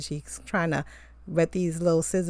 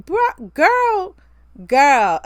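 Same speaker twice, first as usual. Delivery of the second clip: a shocked, outraged shriek of disbelief; stunned exclamation; 9 semitones higher, 9 dB louder, much slower than usual. Real, not acted. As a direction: shoot way up high and loud on each word. Reacting in disbelief to the mistress.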